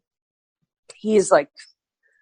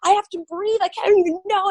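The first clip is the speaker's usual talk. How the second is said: much higher, breathless panic; retold panic; 12 semitones higher than usual. Telling the interviewer her panic on stage, high and breathless. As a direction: replay her onstage fright.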